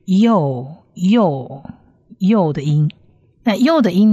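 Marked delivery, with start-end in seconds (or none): 0.0-1.6 "又" as a drawn-out yo syllable; pronunciation demo. Shown as a demonstration. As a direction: say "又" as yo, pause, repeat. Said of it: "所以是".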